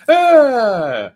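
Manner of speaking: sudden loud high yelp of surprise; a quick real startled cry. Real, not acted. Caught off guard by his own screen, a loud yelp.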